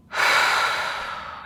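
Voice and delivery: long, airy sighing breath out; audible exhale. Shown as a demonstration. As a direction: let the breath go in a slow sigh. Models breathing out in meditation.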